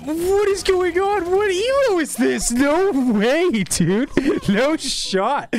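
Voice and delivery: high, stunned disbelief; shocked. Real, not acted. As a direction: cry out in total shock. Shocked by a wild clip.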